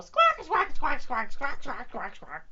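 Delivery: high, loud bird squawks fading out; squawking bird. Acted. Reader voicing the bird squawking at Yeti. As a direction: squawk like a fearless little bird.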